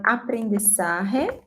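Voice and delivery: "aprendizaje" with J as a Spanish h and S-like Z; Spanish pronunciation. Shown as a demonstration. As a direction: say "aprendizaje" in Spanish, ending -sahe. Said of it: "in Spanish".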